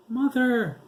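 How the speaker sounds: high, loud shrill scream. A narrator's reading. Girl screaming for her shot mother. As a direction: scream it over the gunfire. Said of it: "Esmeralda screamed".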